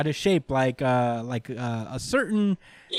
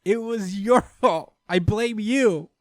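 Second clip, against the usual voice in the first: high, slow, drawn-out blame; mock accusing; 7 semitones higher, slower than usual. Host jokingly blaming co-host for the tangent, mock accusation. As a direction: pin it on them in playful high-pitched indignation.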